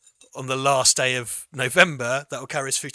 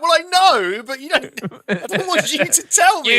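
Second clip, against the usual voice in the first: exasperated protest; 12 semitones higher, 11 dB louder, slower than usual. Pretend. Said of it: "and you're like".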